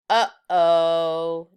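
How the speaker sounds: loud, drawn-out worried uh-oh; guilty uh-oh. Acted. Judy realising her pencil prank is found out, worried. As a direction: caught in the act. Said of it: "Judy thought".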